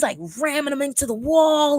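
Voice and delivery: ordinary delivery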